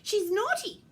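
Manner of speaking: high, loud indignant accusation; complaining. Acted. Reindeer complain about naughty Ruby, high indignant voice.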